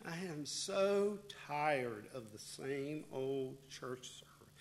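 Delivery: slow, hushed, bored sigh; weary mock complaint. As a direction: drag it out like you're sick of church.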